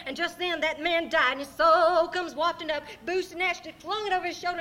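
loud, high and thrilled narrator; excited climax narration. A narrator's reading. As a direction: drive the tale toward its big moment.

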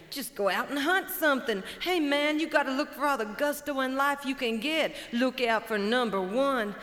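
high, loud, pushy pep talk; brash chicken hawk. Acted. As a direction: hype him up like a hustler. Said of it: "Chicken hawk says".

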